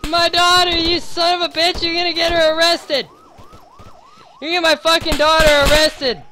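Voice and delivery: high, loud furious rant; angry yelling. Acted. Father yelling at Justin for getting his daughter arrested, furious. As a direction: rage at the man who dragged her in. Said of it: "Sorry I'm yelling at you".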